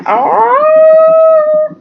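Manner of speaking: high, loud "Oh"; a big mock shocked "Oh"; shocked gasp. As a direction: jolt of surprise. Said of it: "And I was just like".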